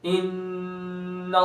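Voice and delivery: sung, slow इन्नल्लाह with a held nasal ghunna on the नून. Shown as a demonstration. Teacher chants the word to show ghunna. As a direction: hum the doubled noon through the nose. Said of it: "गुन्ना करेंगे".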